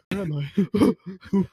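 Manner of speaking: very slow, drawn-out mock confusion. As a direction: imitate someone suddenly lost and bewildered.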